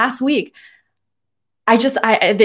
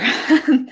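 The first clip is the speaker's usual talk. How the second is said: embarrassed laugh; 8 semitones higher than usual. Real, not acted. Embarrassed after clearing throat.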